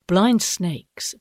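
ordinary delivery

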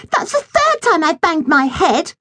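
high, complaining little sister; whiny girl voice. A narrator's reading. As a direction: whine it like a spoilt girl. Millie voiced in the story.

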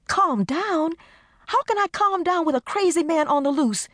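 high, indignant holler. Acted. Desiree hollering back at Carmen's advice, high and indignant. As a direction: throw the words back at her in outrage. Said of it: "Desiree hollered".

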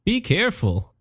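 lightly voiced genie calling out a warning. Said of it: "a voice said".

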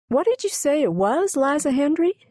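put-on high-pitched little-girl voice; child's question. A narrator's reading. Nellie asks the cook about the cake, high child voice. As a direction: ask in a small child's voice, pitched well up. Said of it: "whispered Nellie".

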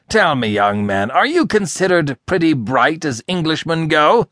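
climbing into a high, needling question; scornful sarcasm. A narrator's reading. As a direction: question his wits with contempt. Boss insults his helper's wits.

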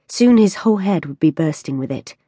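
ordinary delivery, nothing marked

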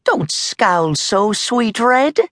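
Molly's teasing voice. A narrator's reading. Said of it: "Molly said".

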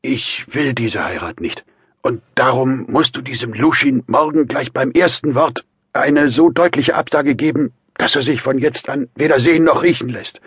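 effortful start building to a loud demand; high, strained, insistent voice; laboured. A narrator's reading. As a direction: force the words out, then insist. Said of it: "fuhr Raskolnikow mühsam fort".

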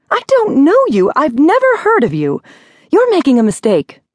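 very high, agitated shrill protest. A narrator's reading. Woman denying she knows the caller, shrill. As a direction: insist shrilly, voice pushed right up. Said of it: "Miss Clarveau said shrilly".

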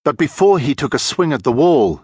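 ordinary delivery, nothing marked

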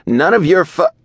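high, loud, spat-out words; spitting angry. A narrator's reading. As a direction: spit it out at him, furious. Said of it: "spitting my words".

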